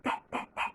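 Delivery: T, T, T breathed with no voice; whispered letter sound. Shown as a demonstration. Teacher whispers the T sound for pupils to guess. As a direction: whisper the T sound three times. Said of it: "quelle lettre nous donne T".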